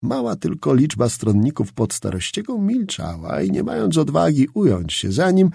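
ordinary delivery, nothing marked

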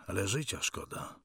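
soft, subdued admission; son's muted sorrow; quiet regret. Acted. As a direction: confess regret under your breath. Son regrets dying.

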